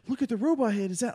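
high, eager child imitation; excited little girl. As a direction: mimic a kid spotting something amazing. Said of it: "She goes".

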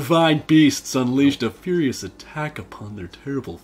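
a loose try at a dramatic narrator; Patrick Warburton voice. Pretend. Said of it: "You're sounding Irish".

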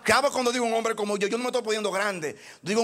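ordinary delivery

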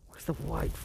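breathy unvoiced whisper to himself. Real, not acted. Painter searching his kit, whispering the question.

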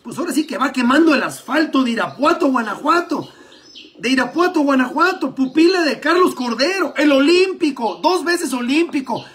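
loud, high sports commentary; live play-by-play. Acted. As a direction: call the race live and excited.

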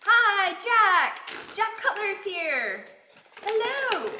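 loud, high-pitched excited hello; calling out a thrilled welcome; excited greeting. Real, not acted. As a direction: greet them with a big, high burst of excitement. Excited greeting of arriving viewer.

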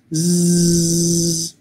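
a long, slow buzzing জ্জ like a phone vibrating. Shown as a demonstration. Teacher imitating a phone vibration for the জ sound. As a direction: hold the জ and let it buzz. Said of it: "মোবাইলের যেরকম vibration হয়".

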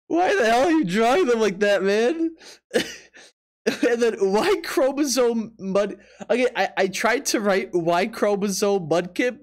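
normal talking voice

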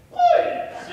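put-on a teen boy's voice snapping into a squeak; voice cracking. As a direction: let the voice break wildly up on the word. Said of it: "because my voice cracked so bad".

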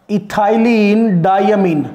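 drawn-out, slightly firm syllables; slow model naming. Shown as a demonstration. Teacher showing students what this is said to be, slow model naming. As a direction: stretch each part of the name slowly. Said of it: "this is said to be".

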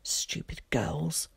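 slow, whispered inner grumble; angry thought. A narrator's reading. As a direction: hiss it privately under the breath. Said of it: "he thought angrily to himself".

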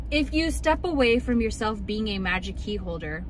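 usual speaking voice

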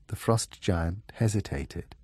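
ordinary delivery, nothing marked

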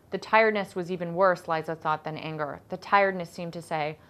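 ordinary delivery, nothing marked

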